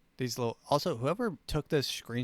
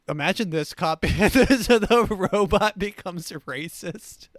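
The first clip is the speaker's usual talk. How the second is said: loud laughing words; 7 semitones higher, 8 dB louder, slower than usual. Real, not acted. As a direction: laugh through the line. Laughing at own joke.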